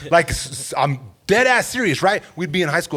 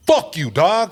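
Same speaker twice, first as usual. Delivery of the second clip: friendly tone; 2 semitones higher, slower than usual. Shown as a demonstration. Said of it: "If it's like".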